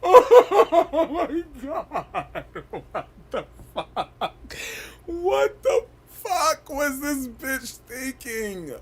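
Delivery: slow wheezing laugh-talk; crying with laughter. Real, not acted. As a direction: squeeze the words out through laughter. Breaks down laughing at clip.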